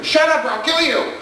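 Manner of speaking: high, laughing mock scream at the kids; hammed-up high yell, through laughter; mock yelling mother. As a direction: shriek it like a frazzled mom. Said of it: "her going".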